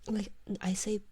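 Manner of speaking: ordinary talk, nothing marked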